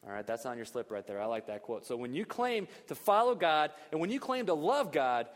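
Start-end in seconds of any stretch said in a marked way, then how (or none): 1.8-5.3 preaching at full force. Acted.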